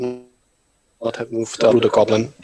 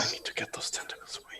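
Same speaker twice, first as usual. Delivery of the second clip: whispering; 18 dB quieter, faster than usual. Real, not acted. Muttered aside to self.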